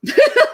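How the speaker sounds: very high, squealing burst of laughter; giddy laugh. Real, not acted. Thrilled at finding the double Aussie, high giddy laugh. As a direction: laugh out loud with glee. Giddy over the menu find.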